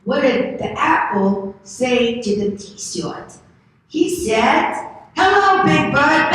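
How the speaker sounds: put-on high, loud, slow toddler voice rising to a sing-song; two-year-old voice. Shown as a demonstration. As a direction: tell the joke like a proud toddler. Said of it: "I have to say it in my little two-year-old accent".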